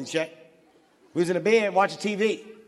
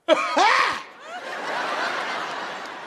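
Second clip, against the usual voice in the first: startled cry; 14 semitones higher than usual. Acted. Man facing the woman turning round, high startled cry. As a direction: cry out, thrown by what you see. Stunned when she turns round.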